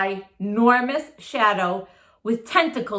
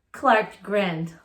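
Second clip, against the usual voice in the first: ordinary delivery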